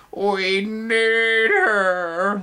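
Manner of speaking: long high drawn-out wail; very slow, high sobbing wail. Acted. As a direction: wail it like a crying child. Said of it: "they wailed in unison".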